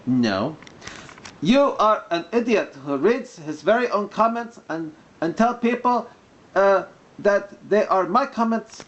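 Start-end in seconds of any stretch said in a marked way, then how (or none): 1.4-8.8 mock Arabic accent. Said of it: "when I'm doing the mock Arabic accent".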